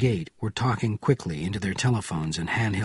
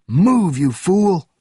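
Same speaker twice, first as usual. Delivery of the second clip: loud, high, angry shout; yelled command; 8 semitones higher, 7 dB louder, much slower than usual. A narrator's reading. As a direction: yell at the fool to move. Benjamin yells at stalling officer.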